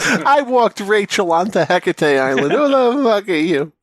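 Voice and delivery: high, loud hammed-up outrage; mock yelling. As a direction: yell it as a joke rant. Joins in the yelled rant.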